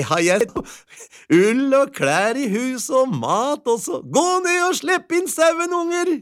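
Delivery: put-on very high, excited old-tale housewife with little laughs; delighted wife. A narrator's reading. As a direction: gush in a high voice, chuckling between thoughts.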